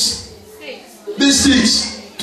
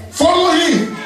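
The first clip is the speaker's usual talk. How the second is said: shouting; 8 semitones higher, slower than usual. Real, not acted. Preacher exhorting congregation.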